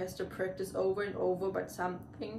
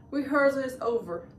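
loud, raised-pitch announcement; yelled; 5 semitones higher, 7 dB louder than usual. A narrator's reading. Fed-up crab conductor to his orchestra, yelling. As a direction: call it off with a yell. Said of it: "the crab yelled and stormed off".

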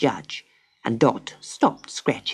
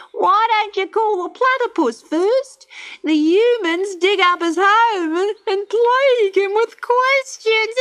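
high, loud bird voice; cheeky laughing bird; high kookaburra voice; 4 dB louder than usual. A narrator's reading.

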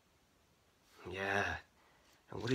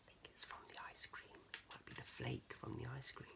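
very soft, whispery comment; hushed aside; 19 dB quieter, faster than usual. Real, not acted. Reader to child looking at the picture, hushed aside. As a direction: drop to a near whisper. Quiet aside to young listener.

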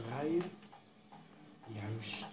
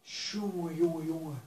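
loud, raised-pitch groan of frustration; exasperated; 3 semitones higher, 8 dB louder, slower than usual. Real, not acted. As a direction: lament loudly over a ruined race. Race spoiled by a crash.

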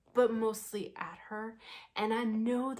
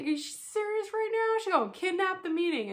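pitched way up, incredulous; hammed-up incredulous question at a rambler; mock disbelief; 10 semitones higher, 5 dB louder than usual. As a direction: squeal it in fake shock.

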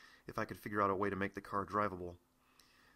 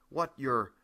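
startled, louder and higher outburst; loud and harsh; 3 semitones higher, 6 dB louder than usual. Acted. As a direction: blurt it out loud, caught off guard. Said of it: "my voice loud and harsh".

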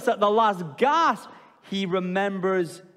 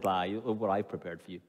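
hushed, quick words from God; God whispering to a drowning Jonah, softer and quick; quiet fast God voice; 13 semitones lower, 9 dB quieter, faster than usual. Pretend. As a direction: murmur it fast and softly as God. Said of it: "the Lord says".

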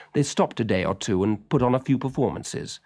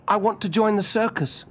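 put-on much higher, bright boy's voice; eager young boy; 11 semitones higher than usual. A narrator's reading. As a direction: voice Willie as a keen little boy.

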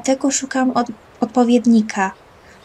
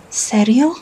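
ordinary delivery

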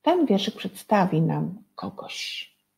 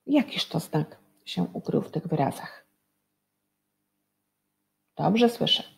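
ordinary delivery

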